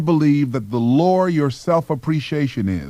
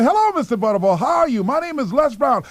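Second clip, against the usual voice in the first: much higher, overly bright hello; a relentlessly cheerful kid; cheery greeting; 12 semitones higher than usual. Retold or imitated. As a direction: greet him as if brand new. Applicant greets boss again.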